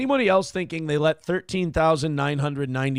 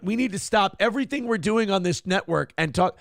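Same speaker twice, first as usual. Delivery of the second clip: usual speaking voice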